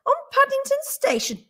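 high-pitched disbelief; scoffing surprise, pitched high; incredulous question. A narrator's reading. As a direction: question it high, as if it were absurd. Mrs Brown disbelieves her husband.

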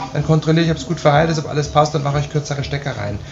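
ordinary talk, nothing marked

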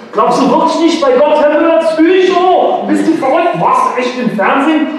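put-on high, cheeky teen voices, overlapping taunts; mock the teacher like a jeering kid; rowdy pupils. Pupils jeering at their maths teacher, high and cheeky.

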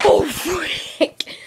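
loud startled outburst; startled shout. Real, not acted. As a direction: blurt it out in fright. Sudden noise scares him.

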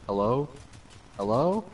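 second "Hello?" rising high; "Hello?" lifted into a question; questioning tune. Retold or imitated. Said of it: "With a question mark that time".

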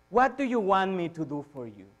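normal talking voice

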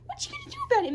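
very high, playful squeal of a dare; squeaky mock taunt. Real, not acted. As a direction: squeak the challenge at the kitten. Taunting kitten, squeaky take.

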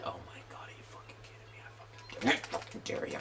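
real seething whisper at the screen; angry whisper. Real, not acted. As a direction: whisper the dare through your teeth. Muttering threat at enemy.

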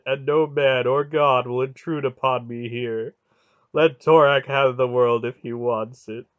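loud, emphatic weeping god; grieving god's refusal. A narrator's reading. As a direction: declare it loudly, with wounded defiance.

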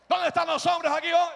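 loud rallying question; calling out. Real, not acted. Preacher calling for the men to answer, shouting. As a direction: summon the crowd with a shout.

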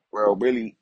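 ordinary delivery

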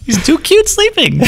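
very high-pitched cooing; mock cooing. Officer won't wake the napping police dog, mock cooing. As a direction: coo over an adorable sleeping pet.

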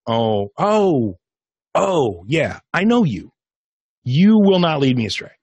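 rising, drawn-out mock realisation; dawning recognition. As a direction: act out suddenly knowing and trusting someone. Acting out recognising the trusted voice.

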